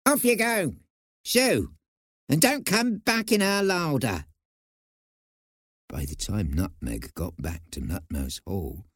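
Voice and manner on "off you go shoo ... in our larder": bossy mouse, less squeaky than the first; second mouse voice. A narrator's reading. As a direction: shoo them off in a high scolding voice. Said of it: "another voice, deeper than the first".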